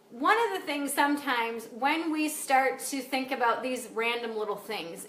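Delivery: ordinary talk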